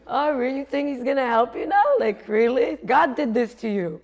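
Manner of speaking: loud, taunting challenge; loud inner voice. Acted. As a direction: pile on the scorn like a nagging voice in the head. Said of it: "It was really loud in my head".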